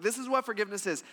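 fast, light run through "This is what forgiveness is"; quick restatement. Acted. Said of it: "This is key".

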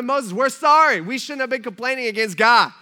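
high, loud retold plea; mock frantic apology. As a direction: cry out an exaggerated apology. People beg Moses for forgiveness.

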